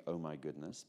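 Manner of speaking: a lightly voiced, low, hushed dismay; a hint of God sighing at the request; mock dismayed God. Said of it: "Father God didn't say".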